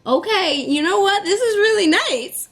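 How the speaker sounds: high, overjoyed exclamation; barely containing the joy; thrilled. Retold or imitated. Reacting to Taylor's card.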